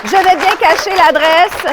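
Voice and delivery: ordinary talk, nothing marked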